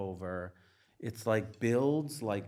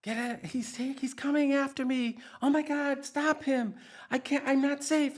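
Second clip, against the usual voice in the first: much higher, louder panicked screams; terrified screaming; 7 dB louder than usual. Retold or imitated. Reliving a childhood terror during a movie, panicked screaming. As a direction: scream it like something is coming for you. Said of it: "I'm, like, screaming like this".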